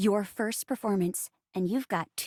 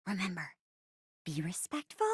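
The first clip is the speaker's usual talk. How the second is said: low, soft, measured reminder; gentle reminder; 6 semitones lower, 11 dB quieter than usual. Acted. Host reminding performers before starting, gentle. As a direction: remind them softly, voice dropped low.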